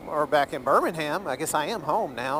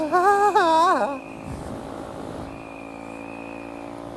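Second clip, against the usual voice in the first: real laugh at own joke; long laugh trailing off. Real, not acted. Laughs at own bad Spanish.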